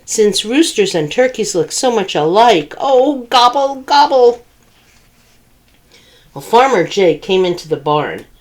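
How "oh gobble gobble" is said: higher, anxious gobbling; worried gobble. Acted. Turkey fretting about becoming dinner, nervous high gobble.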